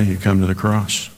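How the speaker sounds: usual speaking voice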